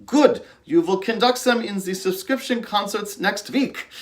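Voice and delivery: high, imitated heavy Dutch accent, firm and matter-of-fact. Dutch music director assigns the apprentice the concerts, heavy Dutch accent. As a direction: deliver the maestro's verdict in a thick Dutch accent. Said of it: "in that heavy Dutch accent".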